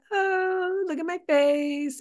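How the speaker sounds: high, mocking sing-out imitation; hammed-up snarky mimicry, very high; saucy, snarky. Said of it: "like, saucy, snarky".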